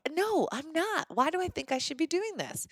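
high-pitched indignant refusal; mock protest. Talking herself out of kayaking in cold water, high protesting voice.

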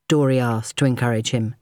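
usual speaking voice

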